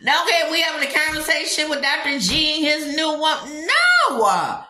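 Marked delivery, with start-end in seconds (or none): 3.7-4.6 louder, higher flat refusal; emphatic shout no. Real, not acted. Reacting to the couple.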